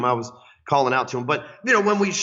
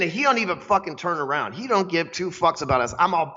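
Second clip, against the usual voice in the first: usual speaking voice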